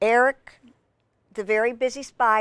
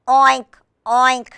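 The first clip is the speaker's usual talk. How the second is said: loud, higher piggy oinks; pig oinking; 4 semitones higher, 7 dB louder than usual. A narrator's reading. Reader invites children to join in, loud oinking. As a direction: oink out like a storybook pig. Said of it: "it's a piggy sound".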